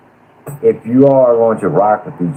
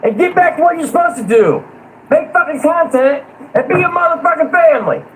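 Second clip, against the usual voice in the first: much higher, furious shout; real fury. Real, not acted. Fed-up panelist losing it at the feuding channel, high furious shout. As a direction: let the real rage push the voice way up. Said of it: "I'm getting pissed right now".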